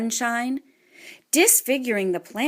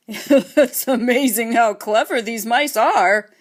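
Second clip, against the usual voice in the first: chuckling; 6 dB louder, slower than usual. A narrator's reading.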